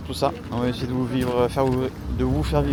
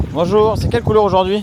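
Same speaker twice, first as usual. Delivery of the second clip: raised voice, pitched up, greeting staff; calling out; 8 semitones higher, 7 dB louder than usual. Real, not acted. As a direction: call it out cheerfully across the entrance. (salue le staff à voix haute)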